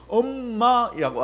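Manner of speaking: put-on slow, drawn-out baby "엄마"; baby saying mama. Pastor imitating a baby's first word, drawn out. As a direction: stretch "엄마" like a toddler's first word. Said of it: "아기들이 이야기합니다".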